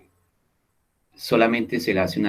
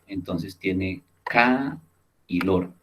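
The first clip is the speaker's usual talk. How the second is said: "ca" then "lor" split apart, slowly; slow syllable-by-syllable "ca ... lor"; 2 semitones lower, slower than usual. Shown as a demonstration. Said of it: "un primer ejemplo es calor".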